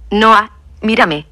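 slow, gentle call of his name; calm voice. A narrator's reading. Narrator calming an angry Noah, slow gentle voice. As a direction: soothe him with his name, slowly. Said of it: "dije con voz tranquila".

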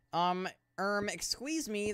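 high, indignant excuse me; mock offended. Player acts offended when the build fails. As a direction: sound mockingly affronted.

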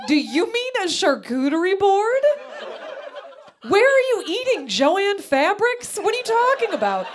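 high, loud comic outburst; incredulous yell. Retold or imitated. Daughter corrects mom's charcuterie mistake, yelling incredulously.

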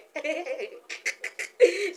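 long, loud high laugh; high-pitched burst of laughter. Real, not acted. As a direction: laugh hard, amused. Amused by baby looking at hair.

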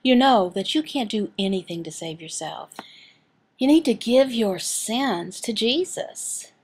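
usual speaking voice

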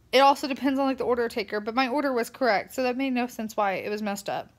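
ordinary talk, nothing marked

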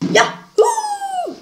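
very high joyful whoop; cheering. Real, not acted. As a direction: whoop in triumph. Weekly high score cheer.

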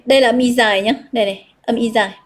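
ordinary talk, nothing marked

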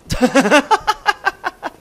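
high, bursting laugh at the screen; real laughter. Real, not acted. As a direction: crack up at the enemy flying away. Laughing at ninja kick.

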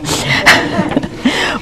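a quick unvoiced chuckle; soft breathy laugh. Real, not acted. Speaker laughs at own impression, soft breathy laugh. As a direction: laugh at yourself, under your breath. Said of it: "because I can't talk that way".

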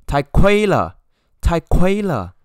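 loud, high complaining "太贵了"; indignant buyer protesting the price. Shown as a demonstration. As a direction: raise your voice, it's too pricey. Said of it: "you should raise up your voice".

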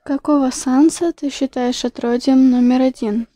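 ordinary delivery

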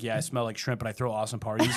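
mock smug shrimp guy. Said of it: "and it's like".

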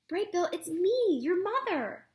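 high, loud, pleading; eager robot mother. Acted. Robot mother calls out to her frightened gosling son. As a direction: reach out to him gently but urgently.

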